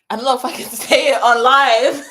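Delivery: laughing it off, a little embarrassed; nervous laughter breaking into the words. Real, not acted. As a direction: giggle through the line. Laughs about saying it live.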